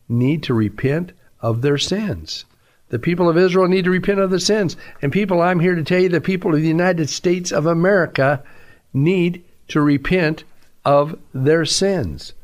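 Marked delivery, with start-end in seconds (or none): 2.9-8.4 fast, higher-pitched sermon push; urgent preaching. Real, not acted. Preacher turning Israel's warning on America, urgent preaching. 8.9-12.3 slow, deliberate, spaced-out phrases; emphatic slow preaching. Real, not acted. Preacher calling the nation to repent, slow emphatic delivery. Hammering home the call to repent.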